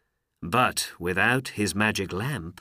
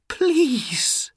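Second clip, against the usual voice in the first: high, loud begging; one imploring cry; desperate plea; 6 dB louder than usual. A narrator's reading. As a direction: beg with all your heart. Aladdin begs the genie.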